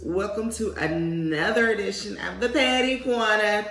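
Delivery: normal talking voice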